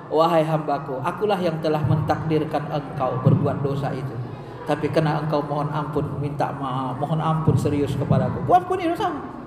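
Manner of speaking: low, measured divine answer; grave and forgiving, kept low; acted reassurance. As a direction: answer the penitent in a low, calm voice. God answering a repentant servant.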